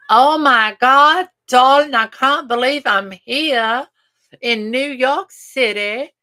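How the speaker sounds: high, slow, hammed-up posh British accent; playful posh British accent, drawn out. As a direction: gush like a mock British socialite. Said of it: "Can you imagine trying to sound ... British".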